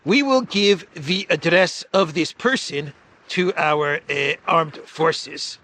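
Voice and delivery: hammed-up threat, slowing on key words; Zelensky impression. Reading a leader's threat to listeners, mock impression. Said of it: "in my Zelensky voice".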